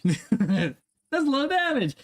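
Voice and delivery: slow, stretched meme line; drawn-out meme quote. Acted. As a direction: drag it out like the famous meme. Repeats meme line twice.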